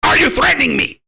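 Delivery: high cartoon whine; mocking high accusation; Cornholio voice. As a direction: squeal it like the cartoon. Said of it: "I am Cornholio".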